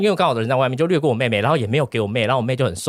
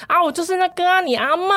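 imitated fawning grandma. Grandma calls the famous grandson, higher fawning grandma voice. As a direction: play the grandma who suddenly flatters her famous grandson, pitched up.

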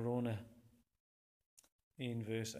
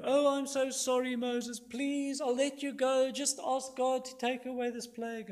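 high, loud whining mock apology; Pharaoh; 11 semitones higher, 6 dB louder than usual. Pharaoh falsely begs Moses to end the plague, fake repentance. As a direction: beg insincerely in a raised whine. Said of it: "Not fake repentance like".

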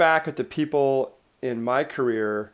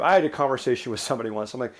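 usual speaking voice

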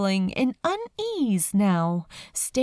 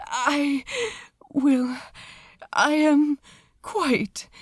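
quivering voice; 4 semitones higher, slower than usual. A narrator's reading. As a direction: force each word out shakily. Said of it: "His voice quivered".

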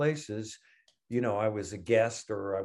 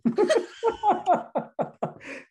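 high burst of happy laughter; delighted laugh; 5 dB louder than usual. Real, not acted. Recalling [name]'s joke, laughing with delight.

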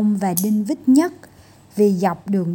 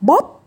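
loud crash; 5 semitones higher, 6 dB louder than usual. A narrator's reading. Crash sound word.

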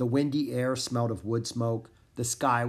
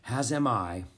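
plain reading voice

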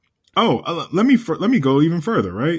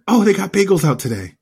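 lightly imitated ex-wife, pitched up; an eager higher quote of the ex-wife; imitating ex-wife; 6 semitones higher than usual. Ex-wife spots bagels at the gym, higher imitation. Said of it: "I remember her being like".

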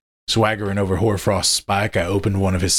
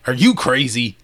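louder, higher incredulous scoff; 5 semitones higher, 4 dB louder than usual. Acted. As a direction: scoff at a crazy accusation. Alpha scoffs at accusation.

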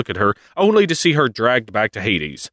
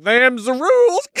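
much higher, odd drawling voice; smug Hades. Retold or imitated. Hades telling Demeter the rules in a goofy high voice. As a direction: shrug it off smugly. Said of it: "he's like".

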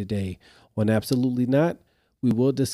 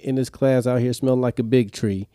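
ordinary delivery

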